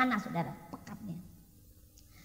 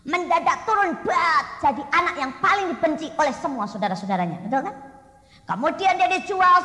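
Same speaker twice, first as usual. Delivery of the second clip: louder, higher preaching voice; preaching at full force; 3 semitones higher, 6 dB louder than usual. Acted. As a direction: drive the story of Joseph home to the crowd.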